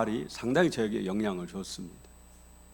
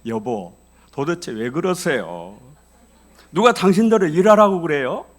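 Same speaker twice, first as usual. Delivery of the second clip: loud, still-angry husband using honorifics; polite but heated; 8 semitones higher, 11 dB louder than usual. Shown as a demonstration. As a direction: keep the anger but wrap it in polite speech. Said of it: "이거를 존칭으로 바꿔봅시다". (존댓말로 바꿨지만 여전히 큰 소리로 따지는 남편)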